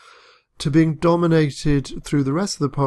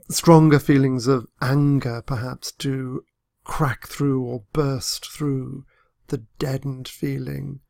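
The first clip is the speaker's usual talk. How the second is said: ordinary talk, nothing marked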